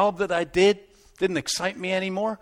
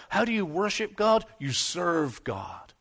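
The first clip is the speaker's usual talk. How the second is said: ordinary talk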